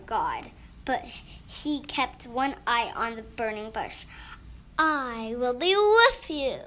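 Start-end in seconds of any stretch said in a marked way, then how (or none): 4.8-6.6 loud, swelling promise; voice of God. A narrator's reading. God reassures Moses at the bush, thundering. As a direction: proclaim it in a big raised voice. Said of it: "said God".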